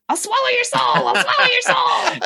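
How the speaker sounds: demon voice. Retold or imitated. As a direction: shout it like a possessed demon. Quoting demon line.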